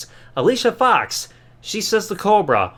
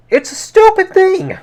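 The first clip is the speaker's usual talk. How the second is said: shrieking much higher and louder in disbelief; exasperated high shout; 14 semitones higher, 8 dB louder than usual. Real, not acted. Reviewer ranting at the sock puppet, a high exasperated yell. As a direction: throw your hands up and yell it high.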